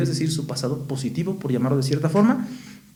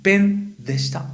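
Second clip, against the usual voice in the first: slowed, raised delivery of "pen deshita", each syllable laid out; slow, careful teacher model; 4 semitones higher, slower than usual. Shown as a demonstration. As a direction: say "pen deshita" slowly and clearly for students to copy. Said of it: "Entonces voy a decir".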